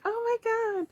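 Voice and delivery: high-pitched imitation of a delighted boy; imitated happy reaction. Retelling the birthday boy's thanks, happy high voice. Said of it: "He was so happy too".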